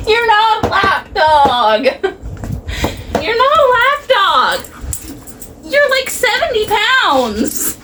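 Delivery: high teasing voice for the pet; dog-talk voice. Real, not acted. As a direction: tease the big dog lovingly, way up high. Teasing the big dog on lap.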